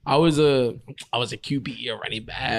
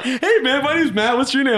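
higher, bouncy imitation of an overeager hello; mock eager greeting; 5 semitones higher than usual. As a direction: ham up the friendly guy introducing himself. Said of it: "you were like".

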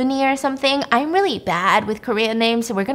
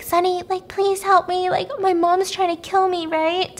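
put-on high and soft, frightened girl pleading for help; a scared girl begging in a high, soft voice; changed voice; 8 semitones higher, 4 dB quieter than usual. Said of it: "suddenly a change in voice".

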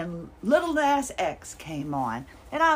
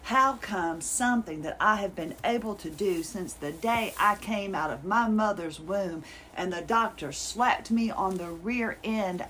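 usual speaking voice

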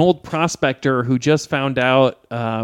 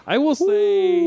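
high sing-song; slower than usual. Real, not acted. Held high note.

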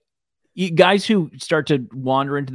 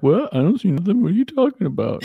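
clueless computer voice; 6 semitones higher than usual. Pretend. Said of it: "my computer's like".

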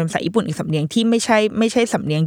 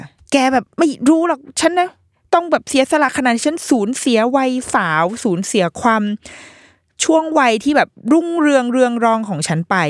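mock aggrieved aunt, pitched higher; retold quarrel; 6 semitones higher than usual. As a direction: act out the aunt's reproach, higher and a little emphatic. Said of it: "น้าเนี่ยทะเลาะกับซูซูเมะ". (เลียนเสียงน้าต่อว่าหลาน เสียงสูงขึ้น)

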